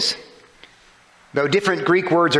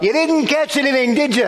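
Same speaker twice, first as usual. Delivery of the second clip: calling out; 10 semitones higher than usual. Acted. Stranger calls out to fishermen.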